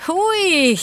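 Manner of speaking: high, startled little cry; startled exclamation. A narrator's reading. Nelli watching the fox fly into the water, startled exclamation. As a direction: exclaim it high, caught off guard. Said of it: "Nelli huudahti".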